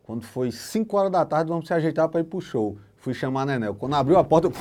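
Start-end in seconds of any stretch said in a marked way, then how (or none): none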